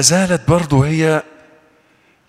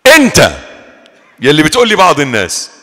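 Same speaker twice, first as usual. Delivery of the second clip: calling out; 7 dB louder than usual. Real, not acted. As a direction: call out to someone in the crowd. Calls out to a listener.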